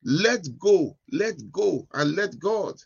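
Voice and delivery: preacher's closing call. Acted. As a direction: urge the congregation to let go.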